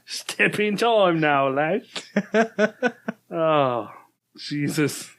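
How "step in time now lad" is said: slow, laughing fake cockney; sing-song mock cockney; slow hammy cockney, laughing; bad cockney accent. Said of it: "Yours is bad enough".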